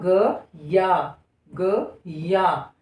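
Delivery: "ग या" spoken slowly as two separate syllables; slow syllable split. Shown as a demonstration. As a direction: break "ग या" apart and say it slowly. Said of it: "पढ़कर देखिए". ("ग या" को धीरे-धीरे अलग अलग बोलना)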